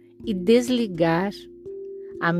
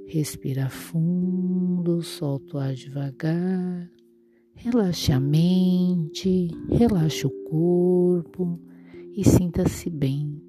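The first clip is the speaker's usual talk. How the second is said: soft spa whisper; 4 dB quieter, slower than usual. Acted. Guided breathing meditation.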